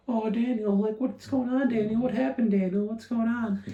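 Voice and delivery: high, nasal-free mock New York reporter voice, well up in pitch; hammed-up New York reporter grilling the player; New York press accent. Sports podcaster mocks New York press questioning Daniel Jones, hammed-up New York accent. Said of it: "that's how people in New York talk".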